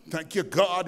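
normal talking voice

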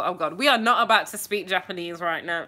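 ordinary talk, nothing marked

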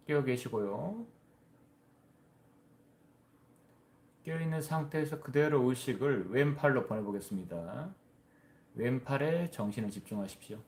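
measured body-scan guidance with long pauses; guided meditation. Real, not acted. (차분히 이끄는 명상 안내)

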